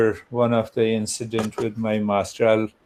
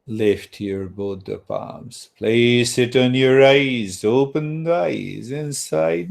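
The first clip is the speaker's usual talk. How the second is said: guided meditation; 3 semitones lower than usual. Acted. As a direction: guide them gently out of stillness, leaving long pauses.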